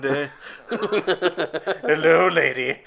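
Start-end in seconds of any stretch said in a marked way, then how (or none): 1.9-2.8 a light put-on Will Ferrell voice, a touch louder; Will Ferrell impression. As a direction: play it like a goofy Will Ferrell greeting. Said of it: "That was very Will Ferrell".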